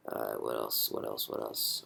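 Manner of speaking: low, soft self-talk; thinking aloud. Real, not acted. Presenter wonders what to show next, muttering to himself. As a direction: mutter it low to yourself while searching for the next point.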